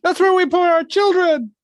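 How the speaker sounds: high, slow silly character voice. Pretend. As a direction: squeak it out like a cartoon. Joke line in put-on voice.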